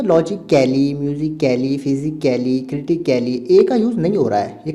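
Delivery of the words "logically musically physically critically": "logically, musically, physically, critically" each drawn to a "kelly" ending; wrong pronunciation. Shown as a demonstration. Teacher listing how the words are not said, mispronounced. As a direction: sound out the A in every "-cally". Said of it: "ये word ऐसे नहीं बन रहे".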